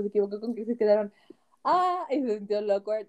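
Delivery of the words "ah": startled gasp. Retold or imitated. Awkward moment gasp.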